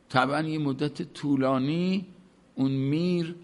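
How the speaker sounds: ordinary delivery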